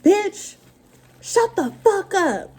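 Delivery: high, slow mock yell at the old lady; retold yell. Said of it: "I was like".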